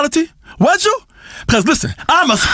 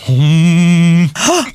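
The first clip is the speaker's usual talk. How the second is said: low buzzing hum; resonant hum; 8 semitones lower than usual. Acted. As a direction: hum so the lips vibrate. Throwing the hum to Cheryl.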